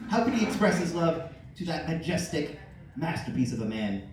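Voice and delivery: usual speaking voice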